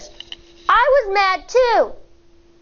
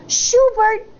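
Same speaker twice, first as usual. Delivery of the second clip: usual speaking voice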